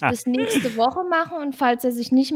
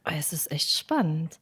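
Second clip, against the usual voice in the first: whispering; 5 semitones lower, 6 dB quieter than usual. Real, not acted. Suspense before winner draw.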